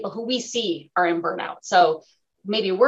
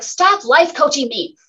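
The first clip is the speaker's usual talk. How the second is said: higher, louder, slow irritated complaint; irritated son; 6 semitones higher, 5 dB louder than usual. Retold or imitated. Mom imitating her irritated son, slow and exasperated. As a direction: drag it out like an annoyed teen. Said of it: "He's like".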